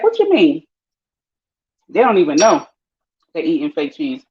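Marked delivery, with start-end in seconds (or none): 0.0-0.6 pitched-up clueless question; high mock confusion. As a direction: squeak it out baffled. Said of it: "They're like".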